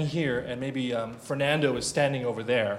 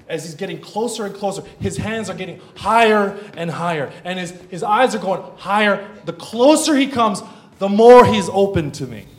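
rising higher and louder to a peak; building preaching; 5 semitones higher, 10 dB louder than usual. Real, not acted. Preacher describes son reaching for him, swelling excitement. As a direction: build excitement with each phrase.

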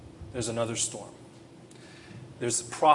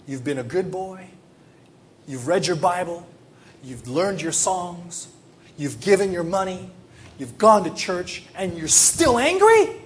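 building exasperation; 6 semitones higher, 8 dB louder than usual. Acted. God confronting a dutiful but angry believer, exasperated. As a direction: start firm and climb to a high, loud, incredulous peak.

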